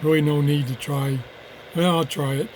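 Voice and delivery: ordinary talk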